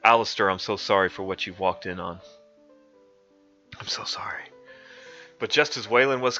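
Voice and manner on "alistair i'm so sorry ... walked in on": ordinary delivery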